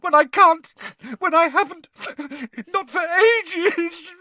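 shrill, tearful wail; very high, hurt outburst; loud and shrill. A narrator's reading. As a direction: wail it loudly in broken bursts. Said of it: "her voice echoed loudly".

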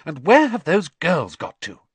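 put-on mother calling for the girls; impatient mother. A narrator's reading. Mother looking for late daughters.

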